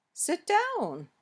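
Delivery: high, swooping "Sit down"; high-pitched emotional request. Shown as a demonstration. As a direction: voice leaps high on "down", then swoops down. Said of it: "each one shows a different feeling or emotion".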